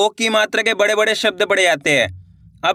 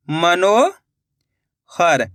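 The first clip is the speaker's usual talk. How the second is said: drawn-out word; 3 semitones lower, much slower than usual. Shown as a demonstration. Teacher to pupils, stretching Manohar slowly. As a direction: stretch Manohar very slowly, every sound held long. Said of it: "किस तरीके से इसका उच्चारण हो रहा है".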